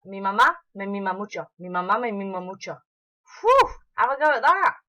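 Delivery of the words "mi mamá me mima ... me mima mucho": "Mi mamá me mima mucho" fired off quickly, over and over; fast tongue twister. Shown as a demonstration. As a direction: rattle it off at top speed. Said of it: "How fast can you do that?".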